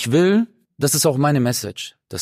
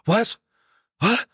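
retold startled what; 8 semitones higher than usual. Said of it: "Und der so".

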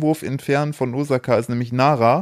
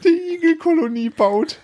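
laughing; 13 semitones higher than usual. Real, not acted. Struggling through laughter.

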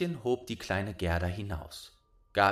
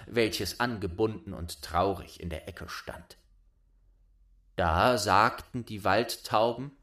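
ordinary delivery, nothing marked

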